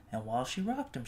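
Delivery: usual speaking voice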